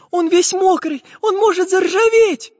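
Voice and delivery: put-on very high, loud, worried girl voice; Nastenka worrying. A narrator's reading. Little girl fears her friend will rust, high and anxious. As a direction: fret in a piping voice. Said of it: "переживала девочка".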